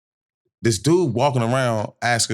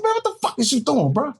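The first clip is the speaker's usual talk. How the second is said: high-pitched, exasperated demand; retold confrontation. Confronting his cousin in the club, exasperated. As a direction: confront him in mock outrage.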